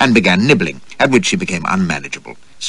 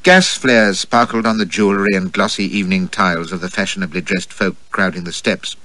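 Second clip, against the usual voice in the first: usual speaking voice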